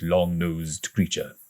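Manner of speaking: usual speaking voice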